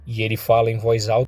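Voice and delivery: plain reading voice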